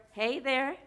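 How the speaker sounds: normal talking voice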